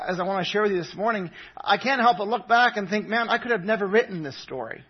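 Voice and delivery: ordinary delivery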